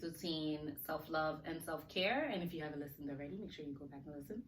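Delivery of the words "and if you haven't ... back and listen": fast, hushed ad read. Acted. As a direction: rattle it off like a radio ad disclaimer. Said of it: "That's my little ad voice".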